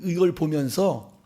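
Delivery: usual speaking voice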